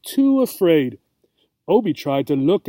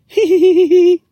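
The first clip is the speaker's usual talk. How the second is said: very high, loud shrieking giggle; delighted shriek; 10 dB louder than usual. Acted. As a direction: squeal with delight. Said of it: "the cat shrieked with delight".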